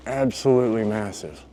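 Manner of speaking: ordinary talk, nothing marked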